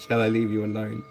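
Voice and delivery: ordinary talk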